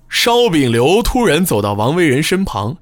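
usual speaking voice

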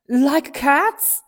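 the offended mouse, shrill with outrage; high angry cry. A narrator's reading. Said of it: "cried the mouse in a high, angry voice".

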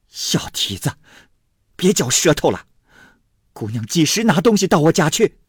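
high-pitched scolding mother. A narrator's reading. Flustered mother scolding the maid, indignant. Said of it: "便骂宝钗道".

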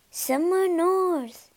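slow, very high coo; cooing pigeons. Acted. Pigeons answer Gerda in a slow, high coo. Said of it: "they cooed".

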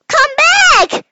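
high, loud call after someone. Acted. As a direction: shout come back, pitched high. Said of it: "cried the little old woman".

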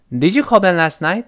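DID YOU CALL BEN LAST NIGHT, rising on NIGHT; question rise. Shown as a demonstration. Teacher leads read-along, question lifted at NIGHT. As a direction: sweep NIGHT upward as a question. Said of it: "最后声音扬上去".

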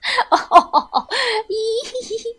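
high, long peals of mocking laughter; helpless laughter. A narrator's reading. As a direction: laugh till you double over. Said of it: "笑得直不起腰了".

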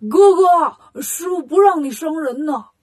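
loud, high call from afar; shouting to brother. Acted. Bajie running up to call Wukong off the robbers, loud shout. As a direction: yell it so big brother hears in time. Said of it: "对孙悟空喊".

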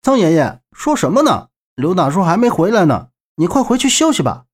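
put-on young man placating an elder. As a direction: hurry the old man off politely.

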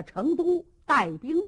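plain reading voice

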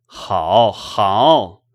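mildly sarcastic, drawn-out approval; cold sneer. A narrator's reading. Husband storms in finding everyone still asleep, sneering coldly. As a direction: sneer it as if through a cold smile. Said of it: "冷笑道".

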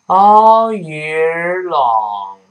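very slow, loud "All year long" with unrounded "All"; "All year long" drawn out word by word for learners; open American vowel. Shown as a demonstration. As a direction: stretch "All year long", opening "All" like a sigh. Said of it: "这么一个声音".

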